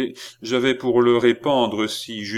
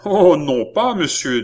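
loud, high protest; vehement objection; 7 semitones higher, 6 dB louder than usual. Acted. Philippe stops the count from leaving, objecting vehemently. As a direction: object sharply to stop him. Philippe refuses to let Balsamo go.

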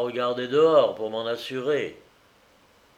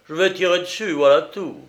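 loud, higher, offhand declaration; affected lightness; 5 semitones higher, 6 dB louder than usual. A narrator's reading. Captor announcing he will shoot, loud affected lightness. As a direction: announce it loudly, feigning a light air. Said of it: "en affectant un air léger".

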